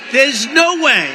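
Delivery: loud, high, cocky no-way; cocky shout. Retold or imitated. Telling the crowd about Iowa, a cocky loud shout. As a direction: shout it, sure of himself. Retelling his cocky reply.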